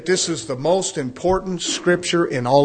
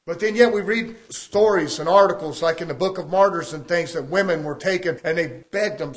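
normal talking voice

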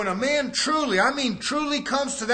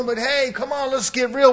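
usual speaking voice

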